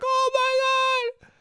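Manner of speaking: high, slow drawn-out squeal; thrilled. Retold or imitated. Younger self told yes, slow high squeal. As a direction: stretch it out in delight. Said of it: "I'm like".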